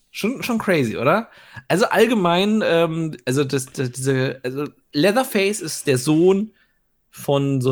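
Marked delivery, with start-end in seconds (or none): none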